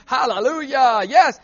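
ordinary talk

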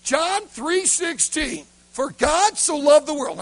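loud, high, drawn-out verse announcement; acted pulpit proclamation; booming preacher. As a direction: preach the verse out at full volume, slowly. Acting out Bill preaching a verse.

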